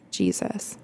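ordinary delivery

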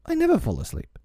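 put-on high boyish assertion; self-assured boast. A narrator's reading. Peter boasting and believing himself, high self-assured voice. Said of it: "quite believing himself".

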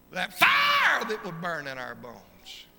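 a slow, high, loud preacher's cry; preaching shout. Real, not acted. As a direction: throw the fire line out at full force. Preacher shouting about spiritual fire.

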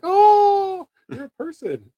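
very high, loud delighted oh; surprised delight. Retold or imitated. Old lady warming up to the salesman, delighted and high. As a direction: gush like a charmed old lady. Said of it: "they're like".

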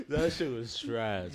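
laughing through the verdict, slowly. Real, not acted. Laughing as he calls the song trash. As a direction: giggle out that it was trash.

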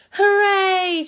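high cheer of elves. Acted. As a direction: let out a drawn-out hooray. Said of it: "cheered the elves".

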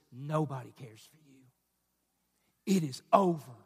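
very low, hushed and slow; anxiety's voice. Acted. Preacher voices anxiety's sermon to the congregation, low and hushed. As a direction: drop right down into a soft, slow message of doom. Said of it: "bottom sermon for anxiety says this".

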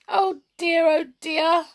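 high, loud mock wailing; woeful storybook sobbing voice; tearful. A narrator's reading. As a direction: wail as if starting to cry. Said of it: "She began to cry again".

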